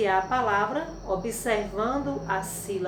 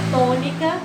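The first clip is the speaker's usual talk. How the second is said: ordinary talk, nothing marked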